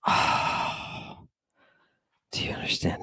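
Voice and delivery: whispered, almost unvoiced "do you understand"; whispered question. Real, not acted. Lecturer asking the audience, in a hushed whisper. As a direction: lean in and whisper it.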